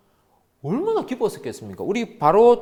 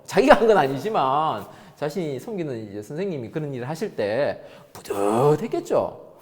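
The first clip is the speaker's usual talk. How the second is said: normal talking voice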